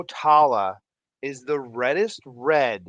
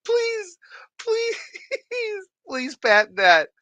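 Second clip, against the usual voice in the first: high, pleading through laughter; laughing plea; 5 dB louder than usual. Real, not acted. Streamer laughing as he begs a viewer to patent it. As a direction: beg while cracking up.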